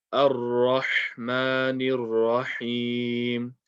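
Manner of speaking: very slow "Ar-Rahmanir Rahim" with round ra and clear ha; careful recitation. Shown as a demonstration. Teacher modelling nice clear reading, careful recitation. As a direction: stretch "Ar-Rahmanir Rahim" out, every letter distinct. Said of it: "ra needs to be round, ha needs to be clear".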